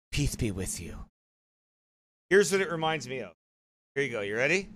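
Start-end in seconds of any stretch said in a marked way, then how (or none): 0.1-1.0 low, soft mock piety; imitated pious blessing. Man mimicking an opponent's parting blessing, mock pious. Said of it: "when he said that whole".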